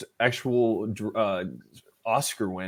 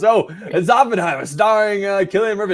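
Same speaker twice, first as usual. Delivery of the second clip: Al Pacino; 8 semitones higher, 5 dB louder than usual. Acted. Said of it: "he goes".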